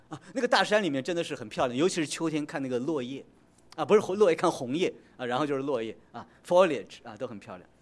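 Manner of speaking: ordinary delivery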